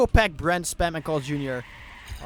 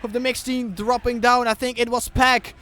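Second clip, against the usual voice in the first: loud, higher live commentary; excited play-by-play; 8 semitones higher, 10 dB louder than usual. Real, not acted. Caster on the deciding map, loud excited play-by-play. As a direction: call the race with energy.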